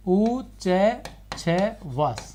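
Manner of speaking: उ च छ वास drawn out very slowly, each syllable apart. Shown as a demonstration. Teacher spelling out उच्छ्वास slowly for students. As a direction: stretch उच्छ्वास into its four syllables, very slow. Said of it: "इसमें च पूरा है".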